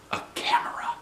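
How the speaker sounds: hushed secret tone; excited whisper. A narrator's reading. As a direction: whisper the big idea. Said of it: "I said".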